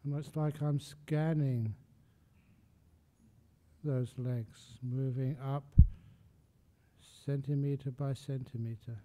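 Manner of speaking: soft, unhurried body scan with long pauses; guided meditation. Real, not acted. Meditation teacher talking listeners up from feet to legs, soft guided meditation.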